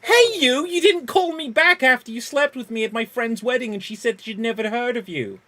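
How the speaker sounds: loud, high, accusing shout; outraged ex confronting a wedding fling; indignant yell. Acted. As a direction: call him out across the room. Accusing a one-night stand.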